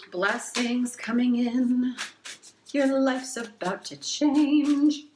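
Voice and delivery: high, slow, sing-song proclamation; slow, high mystical chant of blessings; mystical announcement. Pretend. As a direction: intone it like a fortune teller. Performing blessings ritual.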